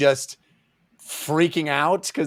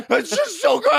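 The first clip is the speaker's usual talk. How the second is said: very high blissed-out squeak; 3 dB louder than usual. Acted. Voicing the blissed-out character.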